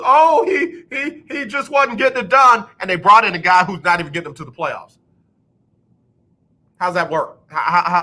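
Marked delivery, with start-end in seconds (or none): 0.0-2.7 high, put-on voice mimicking the front office; mock excuse. Mimicking the team brass excusing the firing, high mock voice.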